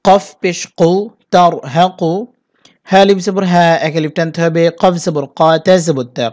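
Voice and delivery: ordinary talk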